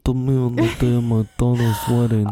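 put-on mafia don voice; mob boss impression. Co-host teases the self-styled mob boss, mafia don voice. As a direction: play a mumbling movie mob boss. Godfather quote riffing on mob talk.

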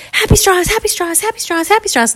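high, gleeful chant; excited, happy kids. Acted. Kids dance around the new straws, chanting gleefully. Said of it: "so excited and happy".

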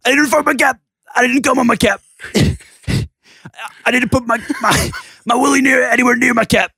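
manic cartoon yelling; Pickle Rick voice. Still reading the tweet as Pickle Rick, cartoon shout. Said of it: "Pickle Rick voice".

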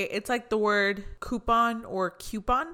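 coupon as KOO-pon, then coupon as KYOO-pon; two pronunciations. Shown as a demonstration. Speaker comparing both ways of saying coupon. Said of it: "I've heard people say both".

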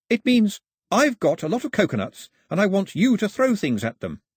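high, animated explainer; lively Pilou. Acted. Pilou translates the song for Mao, high and eager. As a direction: explain it eagerly, pitched well up.

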